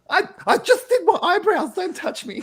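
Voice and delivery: very high, prissy complaint; high-pitched vain footballer parody; mocking Ronaldo. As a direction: play him as a precious diva. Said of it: "Ronaldo goes".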